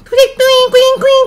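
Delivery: high squeaky string-tightening noise; quick high-pitched twangs; rising string sound. Shown as a demonstration. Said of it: "引っ張れば引っ張るほどなんか高い音出る".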